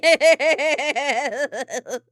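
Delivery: long, loud cackling laugh. Real, not acted. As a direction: burst into a long fit of laughter. Cracking up at a funny story.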